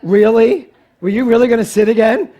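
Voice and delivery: loud, high incredulous question; mock disbelief. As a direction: playfully call out the crowd's mistake. Teases audience failing again.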